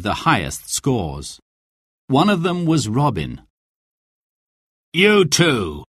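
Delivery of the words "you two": voiced sheriff, a touch higher; sheriff addressing archers. A narrator's reading. Sheriff addresses the last two archers, lightly voiced. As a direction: official calling out two men. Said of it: "said the sheriff".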